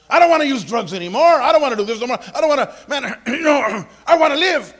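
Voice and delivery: acted plea, loud and high; impassioned preacher voicing a desperate man. Acts out desperate will to live.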